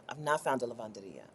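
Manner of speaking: a half-hearted mournful aside; mock-sad murmur. Shown as a demonstration. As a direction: let it sink, muttered to yourself. Repeats lament about no laundromat.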